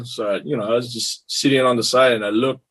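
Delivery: normal talking voice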